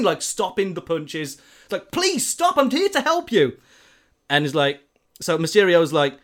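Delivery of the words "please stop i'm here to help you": much higher, desperate begging; panicked plea. Retold or imitated. Peter begging the attacking patients to stop, high and desperate. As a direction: beg them to stop.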